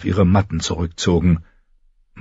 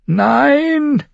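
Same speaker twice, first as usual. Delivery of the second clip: a very high, drawn-out cry of "Nein"; scream. A narrator's reading. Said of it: "schrie sie". (ein hoher, gedehnter Schrei: „Nein“)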